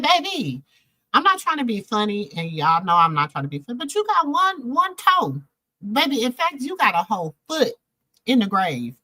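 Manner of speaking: sassy telling-off. Retold or imitated. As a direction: talk down to him with sass. Telling old pastor off, retold.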